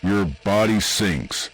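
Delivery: usual speaking voice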